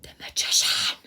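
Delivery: breathy whisper of the card name; whispered reveal. Real, not acted. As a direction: hush it like a secret unveiled. Revealing a drawn tarot card.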